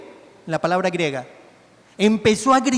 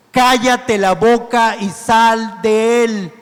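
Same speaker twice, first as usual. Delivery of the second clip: slow, louder, commanding order; forceful rebuke; 5 dB louder, much slower than usual. Acted. Jesus facing the man, ordering the demon out slowly and firmly. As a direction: spell out each word as an authoritative command. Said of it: "y le dijo al demonio".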